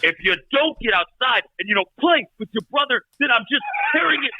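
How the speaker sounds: high, ranting parent; mock parental threat. Mock parent threatening kids in quarantine.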